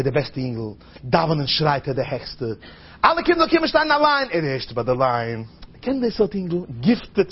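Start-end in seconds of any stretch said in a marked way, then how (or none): 3.0-4.3 high, carried-out announcement; high calling voice. Acted. Calling all children to line up, high-pitched call.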